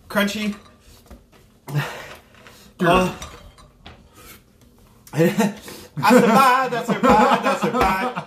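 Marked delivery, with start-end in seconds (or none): 5.1-8.2 high, loud triumphant shouting; excited relief. Real, not acted. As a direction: cheer it out, relieved. Relief after chip challenge.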